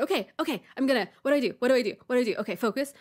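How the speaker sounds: lightly acted flustered self-talk; crisis-mode panic. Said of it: "a little bit of a crisis".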